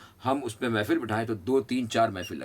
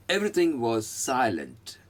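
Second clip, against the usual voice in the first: slow model sentence; much slower than usual. Shown as a demonstration. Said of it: "okay".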